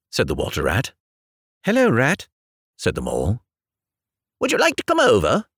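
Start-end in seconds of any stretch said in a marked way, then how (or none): 1.6-2.3 timid mole voice. Acted. Mole greets rat in story. 4.4-5.5 light, quick friendly question; inquiring water rat. Acted. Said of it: "inquired the rat presently".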